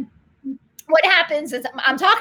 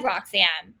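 usual speaking voice

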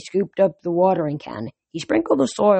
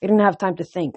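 ordinary delivery

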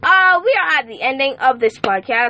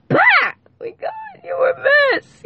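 high, cracking emotional shout; overjoyed cry. Real, not acted. Host crying out to returning listeners with joy. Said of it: "Y'all got me losing my voice".

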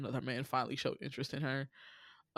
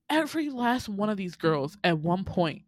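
ordinary talk, nothing marked

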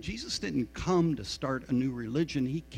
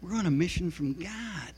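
put-on Chicago accent; 6 semitones higher than usual. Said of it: "in his best Chicago accent".

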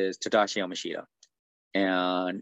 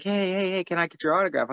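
put-on eager kid; 9 semitones higher than usual. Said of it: "They're like".

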